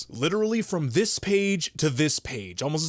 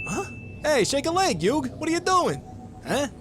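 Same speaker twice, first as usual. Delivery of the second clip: put-on startled teen; 13 semitones higher than usual. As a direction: yelp in shock. Voiced friends, startled.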